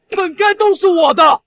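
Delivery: high, pushed, bitter cry; high-pitched angry claim; resentful outburst. Acted. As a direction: burst out with wounded entitlement. Resentful outburst over lost fortune.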